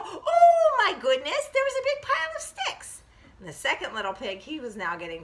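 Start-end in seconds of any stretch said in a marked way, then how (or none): 0.0-3.0 amazed discovery. Acted. Storyteller reveals what the walking pigs found, high and amazed. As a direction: marvel at a lucky find.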